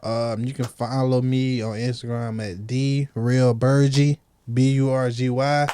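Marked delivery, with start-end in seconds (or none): none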